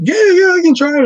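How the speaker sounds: high, loud, eager yes; excited reenacted agreement. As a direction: jump at the request excitedly. Eagerly agrees to a show, retold.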